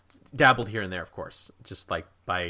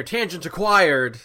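high, loud, drawn-out proclamation; mock announcement; 8 semitones higher, 8 dB louder, slower than usual. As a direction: proclaim it like a game achievement.

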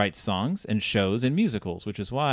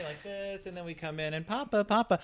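distant, softer voice; far off; 6 semitones higher, 9 dB quieter than usual. Shown as a demonstration. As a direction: sound like it's across the room. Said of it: "we start a little further away".

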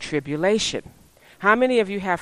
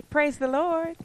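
high, level mock-robot praise; little robot; 8 semitones higher, slower than usual. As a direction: parody a programmed believer. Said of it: "just sit here like a little robot".

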